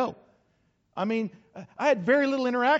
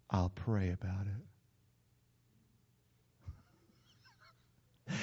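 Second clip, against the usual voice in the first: polite refusal; 12 semitones lower, 12 dB quieter than usual. Acted. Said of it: "the polite way of telling your pastor".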